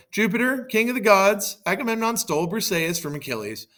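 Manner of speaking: high, loud plea to a god; urgent appeal. Acted. Achilles' mother complains to Jupiter, urgent high appeal.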